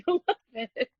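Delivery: soft, laughing retelling. Real, not acted. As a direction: say it through a giggle. Laughing while retelling her own pitch.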